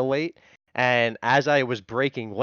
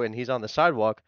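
ordinary delivery

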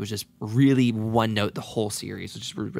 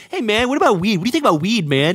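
higher-pitched mock questioner; imitated weed enthusiast; 8 semitones higher, faster than usual. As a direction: put on someone casually asking about weed. Imitates a laid-back questioner.

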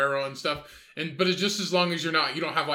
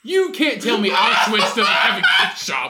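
loud, drawn-out amused insistence; 5 semitones higher, 8 dB louder than usual. Real, not acted. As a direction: argue your point loudly over laughter. Heated comic argument over gift shop.

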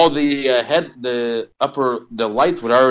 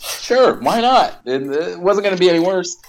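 sarcastic; 9 semitones higher than usual. Real, not acted. Sarcastic agreement about bad casting.

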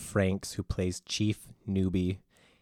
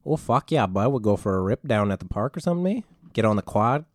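put-on Canadian accent; 4 dB louder than usual. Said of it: "I'll do one too then".

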